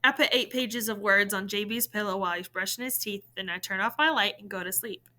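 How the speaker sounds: plain reading voice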